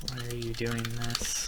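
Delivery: slow, soft and drawn out; slow measured question. Real, not acted. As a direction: ask it quietly, stretching the words. Slowly enters line, then exclaims it.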